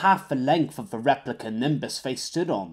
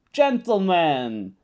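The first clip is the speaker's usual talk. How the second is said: oh-so-pleasant; 4 semitones higher, much slower than usual. Acted. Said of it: "plastering on an oh-so-pleasant smile".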